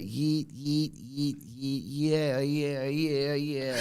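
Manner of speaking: lightly voiced mock cheering crowd; funny voices. Pundit mocking fans with a funny crowd chant. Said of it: "You're doing them funny voices".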